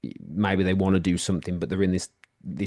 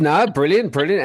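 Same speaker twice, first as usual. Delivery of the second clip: delighted; 8 semitones higher, 7 dB louder than usual. Real, not acted. Host delighted by guest's talk.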